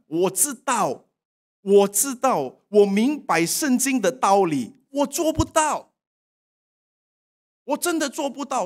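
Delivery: anguished plea. Acted. Pleading confession before God.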